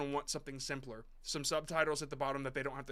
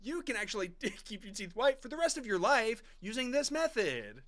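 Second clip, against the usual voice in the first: higher, hammed-up newscaster read; news reporter voice; 6 semitones higher than usual. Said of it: "you sound like a news reporter".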